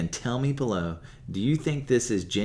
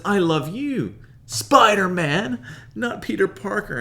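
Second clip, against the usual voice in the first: much higher, louder, slow on Spider-Man; 10 semitones higher, 7 dB louder than usual. Retold or imitated. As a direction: swoon over Spider-Man. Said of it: "She says".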